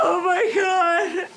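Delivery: crying with joy. Real, not acted. Mother sees her newborn.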